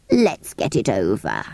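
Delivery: low, slow, reluctant voice; resigned creature. Acted. Creature wanting the wishes over, low and resigned. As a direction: drag it out low.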